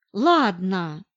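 low, mildly grouchy concession; reluctant, lightly grumbled agreement; grumpy grumble. A narrator's reading. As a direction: grumble it like an old crab, understated. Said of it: "пробурчала я, как старая вредина".

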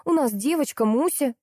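high, petulant insistence; stubborn protest. A narrator's reading. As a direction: insist as if stamping a foot. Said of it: "топнула я". (упрямо, капризно)

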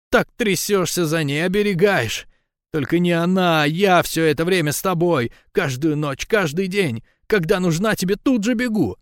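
high, aggrieved woman's voice; upset, high-pitched complaint; hurt reproach. A narrator's reading. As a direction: plead and accuse in a high pitch. Lika's acted quarrel with Gera.

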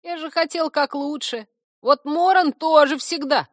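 high, aggrieved creature voice; sulky complaint. A narrator's reading. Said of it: "надулся Корневик".

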